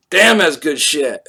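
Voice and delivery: put-on loud, slow drunken praise; drunk delight. Sprawled drunk at the bar, loud slow delight. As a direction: savor the drink loudly, slurring. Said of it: "I'm like".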